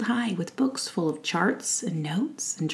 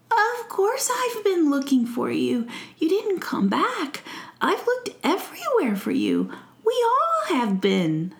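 higher, heartfelt prince voice; earnest prince; 10 semitones higher, 3 dB louder than usual. Acted. Prince telling Faith he searched everywhere, earnest. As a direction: insist warmly, like a relieved prince.

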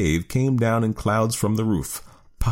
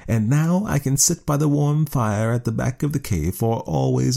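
snooty bored cat; 6 semitones higher than usual. A narrator's reading. Cat voiced in story.

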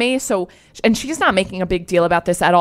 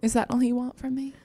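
whispered creep voice, pitched up; creepy caller; 4 semitones higher, 9 dB quieter than usual. Retold or imitated. Imitating the submissive caller's question, creepy whisper. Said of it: "he sounds so creepy".

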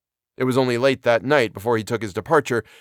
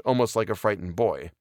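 ordinary delivery

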